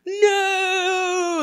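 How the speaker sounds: long, high drawn-out cry of "no"; hammed-up howl of dismay; mock horrified wail. Kids see themselves covered in hair.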